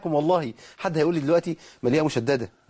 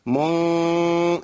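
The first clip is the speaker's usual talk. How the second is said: ordinary talk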